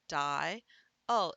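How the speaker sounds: "die-ul" drawn out in two slow parts; split into syllables. Shown as a demonstration. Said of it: "split them up, and I say".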